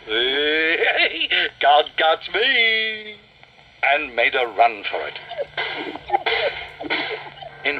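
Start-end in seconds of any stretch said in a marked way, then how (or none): 0.1-3.2 high, drawn-out taunt; sing-song dragon shout; playful shout. A narrator's reading. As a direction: call out teasingly, stretching the words. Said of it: "he shouted".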